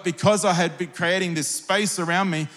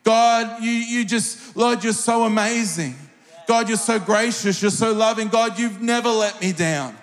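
higher, fervent praise to God; spoken praise prayer; 4 semitones higher than usual. Shown as a demonstration. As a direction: pour out praise like in worship. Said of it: "I would just start to tell God how awesome he was".

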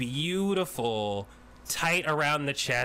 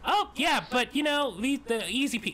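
high excited chatter; 11 semitones higher than usual. Acted. Tiny woman chatters.

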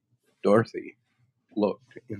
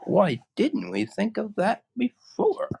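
high, surprised and keen; eager Scarecrow. Acted. Scarecrow to his friends, high surprised delight. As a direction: kick yourself with glee.

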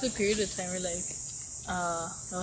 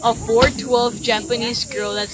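louder and higher, laughing protest; joking shout; 4 semitones higher, 14 dB louder, slower than usual. Real, not acted. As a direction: argue it loudly in play. Heated joking argument over height.

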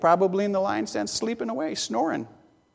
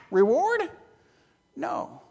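normal talking voice

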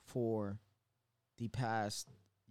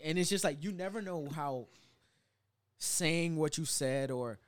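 normal talking voice

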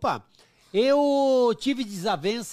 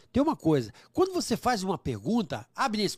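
normal talking voice

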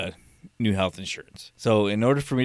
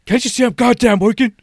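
high angry yell, much louder; retold scream; 14 semitones higher, 10 dB louder than usual. Caller's agent screaming at her kids mid-call, angry yell. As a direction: scream it like a mom losing it. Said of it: "she's just screaming at somebody".

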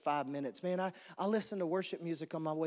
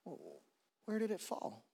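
soft question; 6 dB quieter than usual. Retold or imitated. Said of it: "It wasn't a shout".

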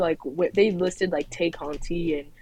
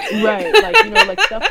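talking through a laugh; 11 dB louder than usual. Real, not acted.

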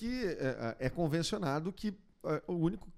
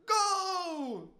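high, drawn-out goal call; goal shout; 12 semitones higher than usual. Retold or imitated. Retold radio guy shouting goal. (grito de gol)

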